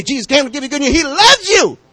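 high, loud preaching to a pet; hammed-up street preacher, high and loud; demo preaching. Shown as a demonstration. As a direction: preach it out to your dog. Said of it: "You'll say".

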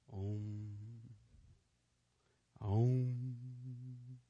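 low, hummed mock om chant; mock meditation om. Preacher mocking meditating, low hummed om. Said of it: "do the".

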